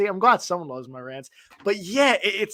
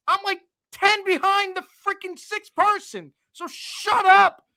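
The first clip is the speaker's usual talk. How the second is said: indignant rant; 13 semitones higher than usual. Real, not acted. Griping to his co-host about league standings, heated and high. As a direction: vent the frustration hard, pitched way up. Ranting about the points tiebreak.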